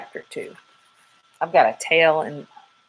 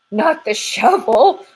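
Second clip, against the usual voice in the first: higher, a bit louder, slow squirrel protest; first stirrings of panic; worried squirrel; 6 semitones higher, 6 dB louder, slower than usual. Acted. Squirrel objecting as Sugar heads for the shovel, slow and worried.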